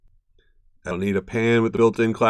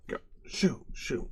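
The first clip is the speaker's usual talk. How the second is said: whispered shoo to the cat; breathy whispered shooing; 4 semitones higher, 11 dB quieter, slower than usual. Real, not acted.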